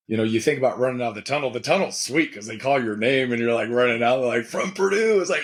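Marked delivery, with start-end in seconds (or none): 4.5-5.2 raised-pitch player introduction; imitated stadium announcer. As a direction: mimic announcing a player onto the field. Imitates stadium announcer calling his name.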